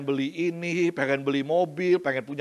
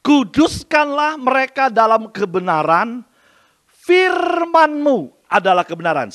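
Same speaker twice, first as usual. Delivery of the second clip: louder, slow solemn scripture reading; khotbah lantang; 6 semitones higher, 9 dB louder, slower than usual. Shown as a demonstration. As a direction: proclaim the verse slowly and loudly. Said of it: "Saya ulangi". (bacakan ayat dengan lantang dan khidmat)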